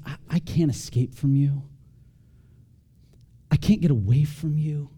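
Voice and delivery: calm, unforced reading at an easy level; peaceful. Acted. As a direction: read it as peaceful gratitude. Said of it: "super peaceful verses".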